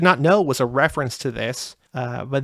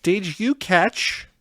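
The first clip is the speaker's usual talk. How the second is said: slow, drawn-out setup with a higher pitch; slow, teasing lead-in to a question; very specific question; 5 semitones higher, much slower than usual. Real, not acted. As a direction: ask it very deliberately, stretching each word. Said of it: "asking it in a very specific way".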